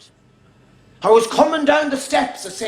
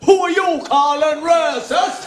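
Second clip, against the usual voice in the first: put-on slow, indignant Irish Traveller accent. Comedian answers the lads in their own Irish Traveller accent. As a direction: fire it back in a Traveller accent. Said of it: "answered them in their accent".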